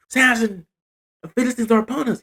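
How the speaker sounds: high, quick warning cry; mock alarm. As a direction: play a woman calling out danger. Delilah's alarm repeated, acted.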